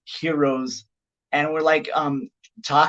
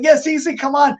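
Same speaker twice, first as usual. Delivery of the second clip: eager invite; 11 semitones higher, 5 dB louder than usual. Retold or imitated. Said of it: "I was like".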